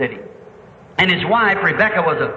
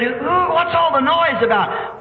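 puzzled calling; 9 semitones higher, slower than usual. Retold or imitated. Blind man asks about the racket.

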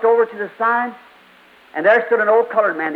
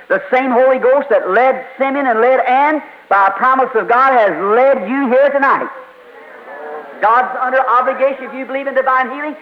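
raised, pressing revival preaching; fervent preaching; 5 semitones higher than usual. Acted. Preacher building to full force.

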